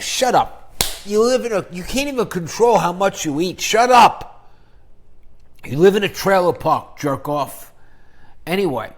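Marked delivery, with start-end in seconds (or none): 0.0-7.7 loud, higher angry rant at a hater; mock rant. Yelling back at a trailer park critic, loud and angry. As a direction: tell him to shut up, yelling.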